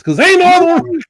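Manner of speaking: excited; pumped, punch it out; hyped up. Real, not acted. Hyping his own show.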